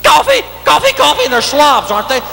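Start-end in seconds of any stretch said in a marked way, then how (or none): 0.0-1.2 very high repetitive squawk; manic waitress. Acted. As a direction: pester with a high, relentless waitress chant. Waitress refills while he drinks.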